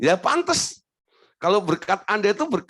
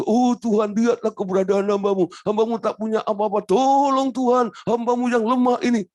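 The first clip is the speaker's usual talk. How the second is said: hammed-up pitiful wail to God, much higher; wailing beggar; 7 semitones higher than usual. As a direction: hammer on heaven's door like a beggar. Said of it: "Jadi kalau Anda bertalu-talu".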